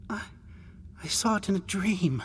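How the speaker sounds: high, hesitant adolescent voice; tearful boy. Acted. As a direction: confess haltingly, pitched well up. Tearful boy explains his fear.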